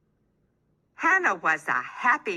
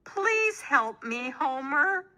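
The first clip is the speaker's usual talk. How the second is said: high, slow pleading howl; mild hammed-up howl; howling plea; 7 semitones higher than usual. A narrator's reading. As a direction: beg Homer for help. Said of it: "Hannah howled".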